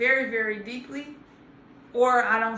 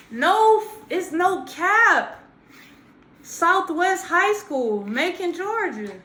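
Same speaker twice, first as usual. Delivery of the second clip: emphatic; 5 semitones higher, 4 dB louder, slower than usual. Real, not acted. Proudly insisting on his school.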